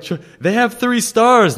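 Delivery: young cook imitated defending the restaurant to coworkers, high and earnest; defensive naive cook. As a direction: plead it like a wide-eyed newbie. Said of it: "I was like".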